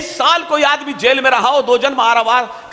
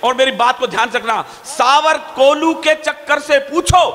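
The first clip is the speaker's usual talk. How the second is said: fiery poem recital; 4 semitones higher than usual. Acted. Poet recites the fresh line to the audience, fiery. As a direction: build the verse like a rally cry.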